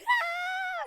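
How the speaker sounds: thin high imitation yell; imitated yelling. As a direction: mimic a crowd yelling, high and held back. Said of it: "everybody in the scene starts yelling".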